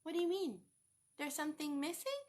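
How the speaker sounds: ordinary delivery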